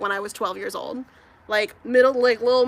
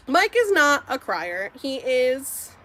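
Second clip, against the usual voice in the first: usual speaking voice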